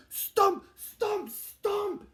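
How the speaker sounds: 1 dramatic stomping. A narrator's reading. Beast stomping back.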